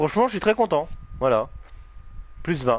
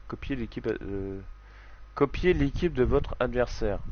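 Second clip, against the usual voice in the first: normal talking voice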